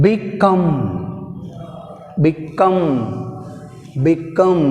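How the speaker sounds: "Become" with COME held and stressed; light Be, strong drawn-out COME in "Become"; second-syllable stress. Shown as a demonstration. Teacher modelling a weak prefix, "Become" stressed on COME. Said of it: "have stress".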